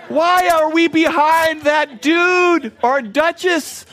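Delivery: high, loud, slow-starting exasperated rant at a slow driver. Retold or imitated. Pastor griping at a slow merging driver, exasperated and loud. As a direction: vent at the dude blocking the lane.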